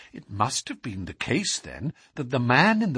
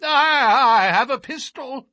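high, loud, quavering warning; reedy, quivering voice; 12 semitones higher, 7 dB louder than usual. A narrator's reading. Frightened man warns intruders from the dark stair, reedy quivering cry. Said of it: "from the darkness came a reedy, quivering voice".

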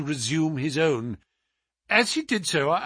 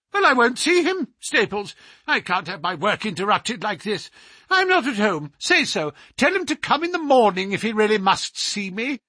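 much higher, louder, fussy and indignant; a peevish scholar scolding his butler, pitched high; high petulant voice; 7 semitones higher, 3 dB louder than usual. Acted. The scholar refusing a visitor to his butler, high and petulant. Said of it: "high, petulant, penetrating voice".

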